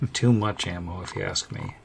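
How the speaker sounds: ordinary talk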